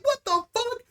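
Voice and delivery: very high-pitched retold shock; mock disbelief. Thrown under the bus by his buddy, high-pitched disbelief. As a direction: squeak it out in acted disbelief.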